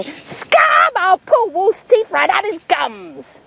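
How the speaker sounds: shouting dentist. Acted. Dentist shouting threats at the wolf, fierce.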